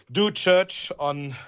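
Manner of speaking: ordinary talk, nothing marked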